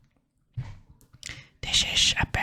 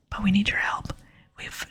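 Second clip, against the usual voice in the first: usual speaking voice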